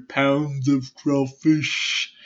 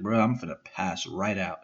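drowsy mumble, lower and much softer; sleepy; 7 semitones lower, 8 dB quieter than usual. Acted. Answering his brother after melatonin gummies, sleepy. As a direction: sound half asleep on the couch.

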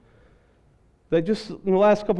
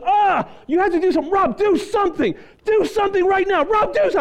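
high-pitched frantic cry for help; panicked wife; 11 semitones higher than usual. Retold or imitated. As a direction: imitate a wife in full panic. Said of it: "She just had a panic attack".

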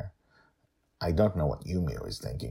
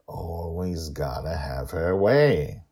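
ordinary delivery

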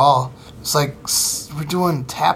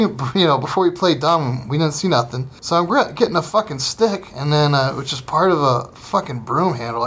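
ordinary talk

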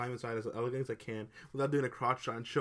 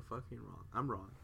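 usual speaking voice

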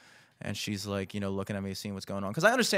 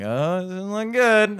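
high, drawn-out comic worry; louder, slow mock dismay; mock alarm; 8 semitones higher, 5 dB louder, slower than usual. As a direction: stretch it out in a rising wail. Mounting alarm, retold.

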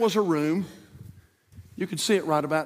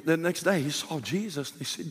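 usual speaking voice

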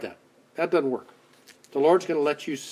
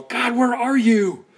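anguished cry; 9 semitones higher, 4 dB louder than usual. Retold or imitated. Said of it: "And we go".

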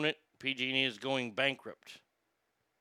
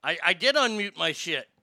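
irritated insistence; 4 semitones higher, 6 dB louder than usual. Real, not acted. Streamer insisting to chat his mic works, irritated. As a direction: insist through gritted teeth.

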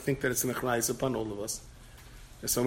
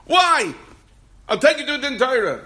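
very high, loud protest; shrieked threat in an acted-out quarrel; mock outraged yell; 13 semitones higher, 10 dB louder than usual. Acts out rejected suitor arguing.

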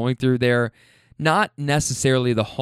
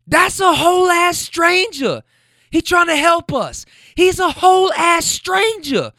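mock screaming; 6 dB louder than usual. Couple screaming over the stranger, imitated high shouting. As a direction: shriek it like the fight on the sidewalk.

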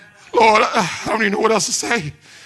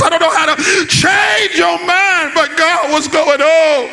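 impassioned preaching; 4 semitones higher than usual. Real, not acted. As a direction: build into a crying-out prayer from the pulpit. Preaches, acting out desperate prayer.

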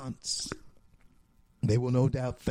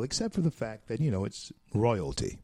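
normal talking voice

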